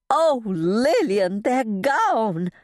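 high, drawn-out cry of panic; gasped in distress. A narrator's reading. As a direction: gasp out terrible news. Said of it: "I gasped".

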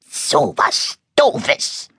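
sehr langsam gefaucht; verächtlich abfauchen; fauchend. Acted. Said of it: "faucht das Monster nur". (das Monster faucht Moritz verächtlich und sehr langsam an)

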